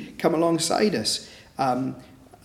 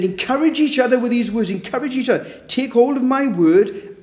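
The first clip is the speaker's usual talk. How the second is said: fervent command; 9 semitones higher than usual. Pretend. Voicing the Lord's command.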